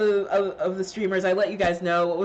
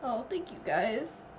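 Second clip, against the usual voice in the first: choked up; 3 semitones higher, 8 dB quieter, slower than usual. Real, not acted. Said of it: "I didn't mean to get emotional".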